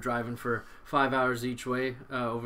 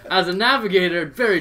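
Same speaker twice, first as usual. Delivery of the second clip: laughing; 5 semitones higher, 11 dB louder than usual. Real, not acted. Still laughing at the blunder.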